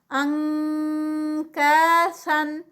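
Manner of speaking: انکاسن slow with the نون as an nk sound; nk recitation. Shown as a demonstration. Teacher demonstrates the ink sound slowly. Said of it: "کاف کے مخرج پہ direct گئے".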